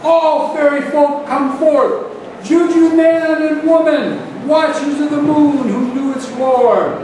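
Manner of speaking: very loud, high incantation; full-force epic invocation, pitched high; fervent bellowing. A narrator's reading. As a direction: bellow a summons to the spirits. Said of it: "he bellowed to the air".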